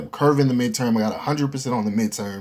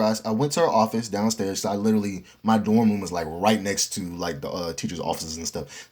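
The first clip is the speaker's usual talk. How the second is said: ordinary delivery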